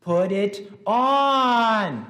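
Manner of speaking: slow booming yell, pitch way up; yelled command. Shown as a demonstration. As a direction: bellow it at the kids. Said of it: "you could simply yell at them".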